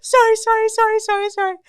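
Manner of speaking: high, loud, frantic wailing sorries; frightened boy wailing his apology up at the tree; panicked crying apology. Retold or imitated. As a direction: beg in a screaming panic. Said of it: "he screams and cries".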